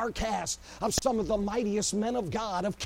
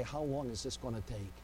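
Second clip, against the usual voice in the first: hushed lament; 8 semitones lower, 11 dB quieter than usual. Acted. Quoted prayer, low.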